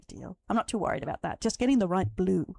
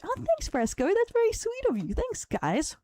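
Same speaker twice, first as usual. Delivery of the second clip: cutesy high voice; 13 semitones higher than usual. Real, not acted. Thanked by chat, cutesy voice.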